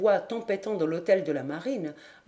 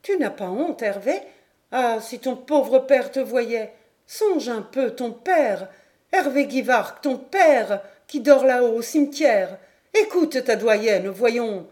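put-on higher-pitched old landlady reproaching; indignant motherly appeal, building in force; insistent scolding; 3 semitones higher, 6 dB louder than usual. A narrator's reading. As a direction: plead and shame him, getting louder.

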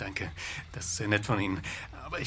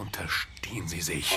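low, slow whispered warning; hissed warning; 6 semitones lower than usual. Acted. Officer whispers a warning back at the blackmailer. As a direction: whisper it back through your teeth.

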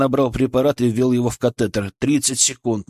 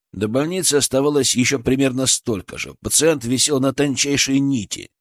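usual speaking voice

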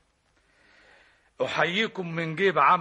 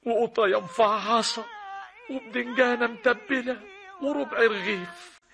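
ordinary talk, nothing marked

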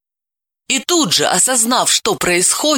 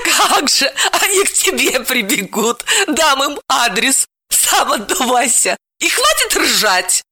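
mock scolding; 9 semitones higher than usual. A narrator's reading. Wife scolding laughing husband.

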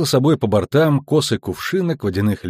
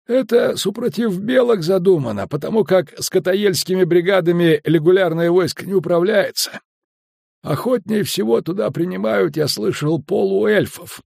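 higher-pitched, folksy storyteller skipper; chatty skipper; 7 semitones higher than usual. A narrator's reading. Skipper telling Geralt about a river otter, chatty and folksy. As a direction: spin a village yarn as the talkative skipper.

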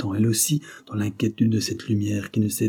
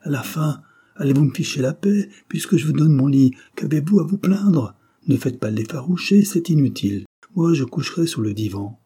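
louder, higher, exasperated scolding; irritated rant, raised voice; angry outburst; 5 semitones higher, 4 dB louder than usual. Acted. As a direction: bark it at her like a fed-up host. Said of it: "il entra en colère".

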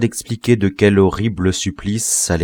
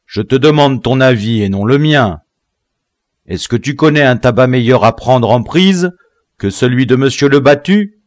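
loud, raised, demanding voice; imperious interrogation; impatient questioning; 5 semitones higher, 7 dB louder than usual. A narrator's reading. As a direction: press the prisoner hard for an answer. General presses flustered prisoner.

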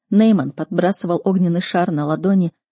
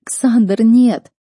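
high, loud protest; desperate cry; 5 semitones higher, 7 dB louder, much slower than usual. A narrator's reading. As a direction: beg him to stop. Heroine resists being picked up.

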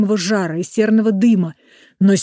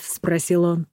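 ordinary delivery, nothing marked

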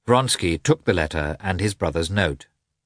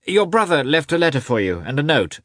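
high, raised-voice announcement; calling after someone; 8 semitones higher, 4 dB louder than usual. A narrator's reading. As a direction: call the news out to someone leaving. Calling after departing friend.

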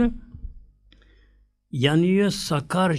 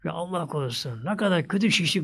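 ordinary delivery

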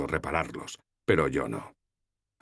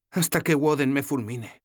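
put-on higher, lighter young woman's voice; voiced young heroine, gently teasing; Sefawin's voice; 9 semitones higher, 3 dB louder than usual.

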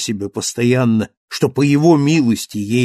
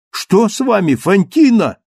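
alarmed exclaim; 6 semitones higher, 4 dB louder than usual. Acted. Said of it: "она опять воскликнула".

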